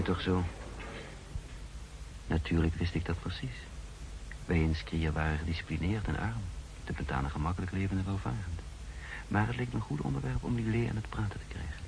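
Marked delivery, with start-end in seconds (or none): 2.3-11.8 quiet reflective narration; story narration. A narrator's reading. Narrator explaining his hidden motive, quiet and reflective. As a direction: muse on it softly, as a private thought.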